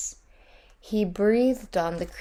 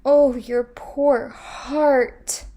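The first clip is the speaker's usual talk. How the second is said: higher, louder, slow sympathetic moan; 6 semitones higher, 7 dB louder, much slower than usual. A narrator's reading. Tiny creatures moaning with the queen to comfort her. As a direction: moan along with her, drawn out. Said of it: "moaned the tiny creatures".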